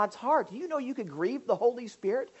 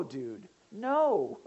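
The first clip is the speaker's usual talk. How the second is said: usual speaking voice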